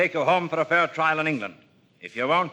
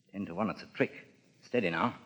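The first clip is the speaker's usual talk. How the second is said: much softer, lower, wary caution; low warning; 7 semitones lower, 12 dB quieter than usual. Acted. The captain warns his men of a trick, low and hushed. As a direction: keep it down so the enemy can't hear.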